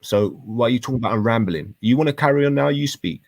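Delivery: usual speaking voice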